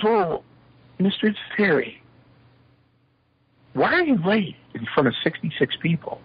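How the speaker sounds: high-pitched mock professor scolding; imitating reproachful professor. Professor confronts late student.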